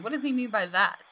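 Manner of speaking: ordinary talk, nothing marked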